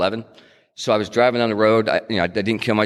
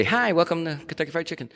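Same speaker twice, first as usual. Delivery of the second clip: high, softer mock cashier voice; imitating the counter girl, pitched up and soft; cashier greeting; 9 semitones higher, 5 dB quieter than usual. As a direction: chirp it like a fast-food cashier.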